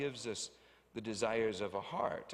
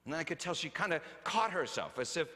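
ordinary talk, nothing marked

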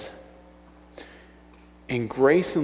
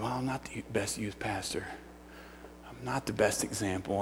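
self-doubt; 3 semitones lower, 11 dB quieter than usual. Retold or imitated. Voicing his own self-doubt.

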